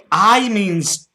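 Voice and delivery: loud, high Scottish voice; broad Scottish accent. Pretend. Prank caller explaining "aye" to scammer, loud Scottish. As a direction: explain it loudly as a put-on Scot.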